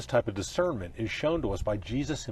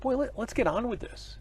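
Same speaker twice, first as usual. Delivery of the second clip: high-pitched, mock-impatient aside; retold impatience; 12 semitones higher than usual. Pastor recalling the long wait to be chosen, impatient. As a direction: imitate your own impatient inner voice, pitched well up. Retells his own impatient thought.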